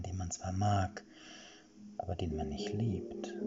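soft, seductive whispering voice in his head; whispered. Acted. Witch's voice whispers inside Erik's head, seductively soft. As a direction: slowly whisper his longings to lure him. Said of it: "flüsterte die Stimme in seinem Kopf".

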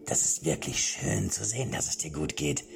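high, breezy voice with whispered peaks; mad old king. Acted. Mad king cheerfully admits shooting the guards. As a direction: confess the killings cheerfully like a madman.

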